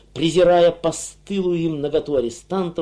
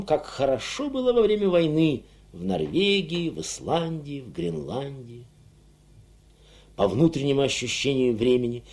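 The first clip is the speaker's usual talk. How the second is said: usual speaking voice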